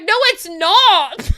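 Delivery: high, loud and slow, flatly refusing; mock outraged retort; emphatic loud no. Retelling her reply to her brother, loud and slow. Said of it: "And I was like".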